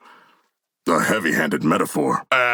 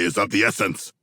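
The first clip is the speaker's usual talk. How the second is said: usual speaking voice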